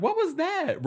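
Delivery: retold alarm, voice jumping up; mock fright. Fans reacting to Rosie screaming backstage, mock fright. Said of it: "we all were scared".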